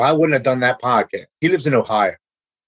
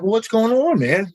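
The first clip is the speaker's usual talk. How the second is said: surprised greeting; 11 semitones higher than usual. Retold or imitated. Surprised call from old Marine friend.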